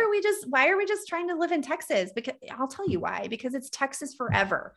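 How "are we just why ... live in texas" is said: pitched way up, fast and eager; excited realization. Retold or imitated. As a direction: burst out with the new freedom.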